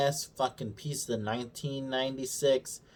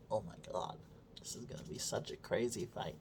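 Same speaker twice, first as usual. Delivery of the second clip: hushed awe; 3 semitones lower, 16 dB quieter than usual. Retold or imitated. Voicing viewer's hushed anticipation.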